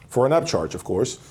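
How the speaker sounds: usual speaking voice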